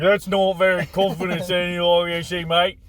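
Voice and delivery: light cockney droid voice; a hint of cockney C-3PO; British accent. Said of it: "I love that voice".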